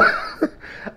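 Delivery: breathy, wheezing laugh; spent, airy giggling; helpless laughter. Real, not acted. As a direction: laugh until no voice is left. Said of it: "I'm laughing".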